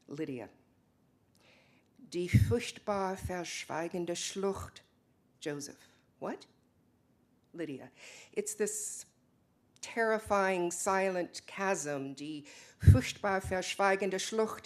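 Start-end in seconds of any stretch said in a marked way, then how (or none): 2.1-4.8 reading poem. Acted. Lydia reading German poem aloud. 12.1-14.7 reciting poem. Acted. Lydia quotes German line again.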